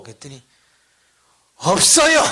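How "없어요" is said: high, loud, curt retort; forceful denial. Retold or imitated. Deacon refusing the pastor's consolation, forceful loud denial. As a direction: throw it back with too much force. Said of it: "힘을 좀 빼시고".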